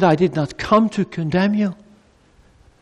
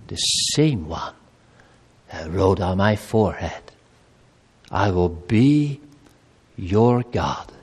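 hushed confession prayer; 8 semitones lower than usual. Acted. Soft quoted confession of faith.

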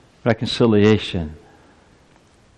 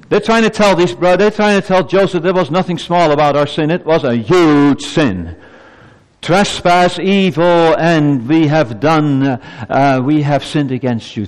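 raised, insistent sermon voice; impassioned preaching; 5 semitones higher, 4 dB louder than usual. Real, not acted. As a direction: start high and press hard, easing down gradually. Preaches at full force.

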